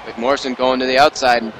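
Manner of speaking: ordinary delivery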